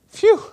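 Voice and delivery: high, loud puff of relief; relieved phew. Acted. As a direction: blow out a mock sigh of relief. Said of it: "he's relieved".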